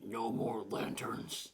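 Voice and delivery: slow, spooky whisper; ominous whisper. Pretend. Joking that the movie cuts the lanterns, in a slow whisper. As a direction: hiss it out slowly like a threat.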